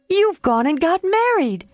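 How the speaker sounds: Jo pounces on his secret; shocked exclamation. A narrator's reading. Jo discovering the marriage.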